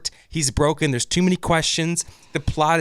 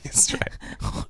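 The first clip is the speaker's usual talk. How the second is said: breathy whispered agreement; 7 dB quieter than usual. Real, not acted. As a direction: whisper it conspiratorially. Repeats it under his breath.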